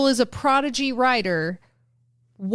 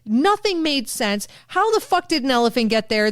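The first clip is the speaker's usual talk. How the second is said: ordinary delivery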